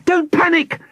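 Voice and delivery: very high-pitched urgent cry; girl's yell. A narrator's reading. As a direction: yell it like a plucky little girl. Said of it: "Nina Moot yelled to Professor Elseviera".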